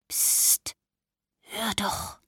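hushed whisper, barely voiced; old woman whispering. A narrator's reading. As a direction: whisper it so nobody hears. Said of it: "flüsterte die Oma".

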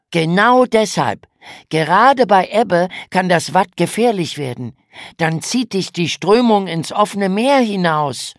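höher und lauter, ernst warnend; besorgter großer Bruder. A narrator's reading. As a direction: wie ein besorgter Bruder erklären. Lukas erklärt die Gefahr. (großer Bruder warnt die Schwester, höher und lauter)

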